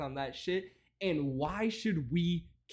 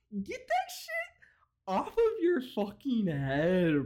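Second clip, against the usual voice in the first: drawn-out high mock voice; 5 semitones higher, slower than usual. Repeats mock drawl.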